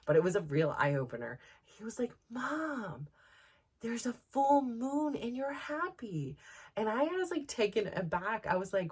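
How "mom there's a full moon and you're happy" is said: put-on high, slow, innocent child; little boy voice. Young son explaining the full moon to mom, high childlike voice.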